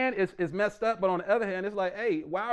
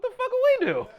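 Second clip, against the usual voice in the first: high, loud incredulous outburst; mock outrage; 7 dB louder, faster than usual. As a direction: squeal it in mock disbelief. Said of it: "It was like".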